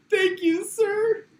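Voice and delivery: high-pitched, tearful gratitude, hammed up; weeping with joy. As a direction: thank him as if overcome with joy. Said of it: "he just starts weeping with joy".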